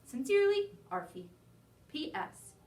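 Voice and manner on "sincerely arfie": perky sign-off. Acted. Dog signs letter.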